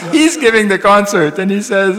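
amused, laughing delivery. Real, not acted. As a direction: talk through real laughter. Laughing while retelling.

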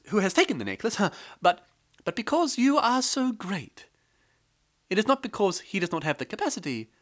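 high, nervous wife voice, pitched well up; wife pleading to the king. A narrator's reading. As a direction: flatter the king with a high, anxious voice. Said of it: "The wife told the king".

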